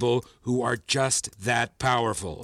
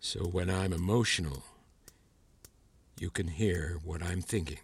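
low, soft and careful; stunned realization; 7 semitones lower, 7 dB quieter than usual. Acted. Teacher learning student reads thoughts, low and hushed. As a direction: piece it together quietly, low in pitch.

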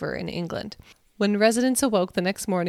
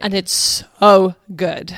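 slow, loud gush of excitement; excited; 9 dB louder, slower than usual. Real, not acted. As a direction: draw out so, thrilled. Excited about next episode.

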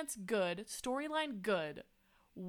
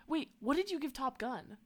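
usual speaking voice

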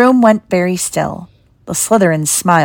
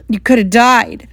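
mildly acted tearful outburst; a touch of a sob; sobbed; 3 semitones higher, 4 dB louder than usual. A narrator's reading. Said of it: "sobbed Hagrid".

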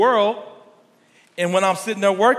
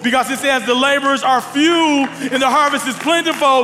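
louder, higher preaching drive; full preaching; 6 semitones higher, 4 dB louder than usual. Acted. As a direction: push the call to serve at the crowd. Preaching to congregation.

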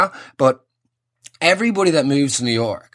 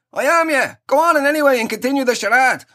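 hammed-up matey ad voice, much higher; cheesy radio ad bloke; 9 semitones higher than usual. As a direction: answer like a fake pal in a staged ad. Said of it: "You know the ones".

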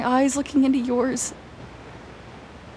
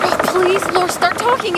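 urgent plea; 8 semitones higher, 5 dB louder than usual. Acted.

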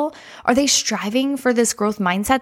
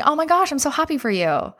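gushing; 7 semitones higher than usual. Retold or imitated. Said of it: "they're like".

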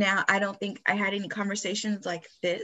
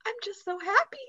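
put-on high little-girl voice, overjoyed and teary; imitating little daughter; 13 semitones higher than usual. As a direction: play the youngest daughter, much higher and tearfully happy. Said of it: "she looks at the camera and she goes".

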